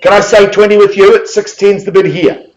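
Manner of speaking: high, loud auction patter; rally the room like an auctioneer; auctioneer's call. Acted. Auctioneer calling for bids.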